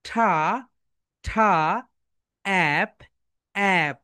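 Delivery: "ঠা" and "অ্যাপ" split apart with pauses between them; segmented sounds. Shown as a demonstration. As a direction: break the word into its sounds, slowly. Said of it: "সবাই সুন্দরভাবে segment করে ফেলো".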